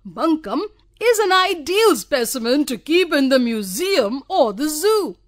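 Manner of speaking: loud, high sneering tease; mocking. A narrator's reading. Nidhu Babu jeering at Bonku Babu, loud and sneering. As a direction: jeer at him for the room. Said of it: "Nidhu Babu added".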